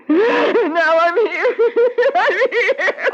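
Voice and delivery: laughing through tears, unhinged; hysterical laughing. Acted. Wife losing her mind in the jungle, hysterical laugh-crying. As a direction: laugh as she falls apart.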